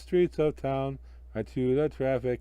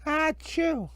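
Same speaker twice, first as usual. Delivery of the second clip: high sharp acted sneeze; a girl's sudden sneeze; cold little girl sneezing. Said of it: "But for Karen".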